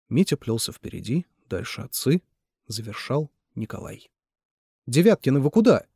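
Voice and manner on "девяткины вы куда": loud, higher call across the yard. A narrator's reading. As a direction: shout after them to stop them. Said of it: "крикнула им Милана". (Милана громко окликает уходящих мужчин)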